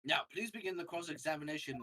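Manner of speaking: normal talking voice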